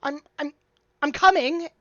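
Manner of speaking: very high, loud, harried voice; flustered dwarf shopkeeper. Acted. Dwarf shopkeeper hurrying to a yelling customer, flustered. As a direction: call out high and fussed, stumbling over words.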